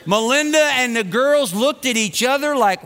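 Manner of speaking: ordinary delivery, nothing marked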